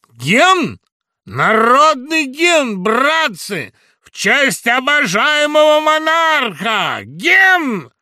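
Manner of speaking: громкий высокий крик толпе; выкрикни требование гимна, растягивая слова. Acted. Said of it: "крикнул". (громко, требовательно выкрикивая)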